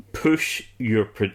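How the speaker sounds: normal talking voice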